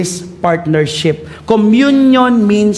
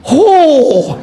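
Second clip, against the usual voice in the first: startled ho; 5 semitones higher, 4 dB louder than usual. Retold or imitated. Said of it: "Sabi niya ganun".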